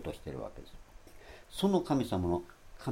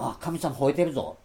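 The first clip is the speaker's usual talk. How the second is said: higher, louder mock alarm at 吠えてる; mock startled remark; 6 semitones higher, 8 dB louder than usual. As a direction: exclaim as if you really heard God roar. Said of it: "って言いますかね".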